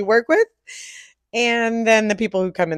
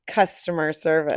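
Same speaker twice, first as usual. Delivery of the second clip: ordinary delivery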